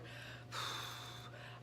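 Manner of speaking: a long, very soft, breathy exhale, no voice; long sigh. Retold or imitated. Pulled over in the car, she lets out a slow calming sigh. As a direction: let out a slow breath to collect yourself. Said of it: "I just took my breath for a minute".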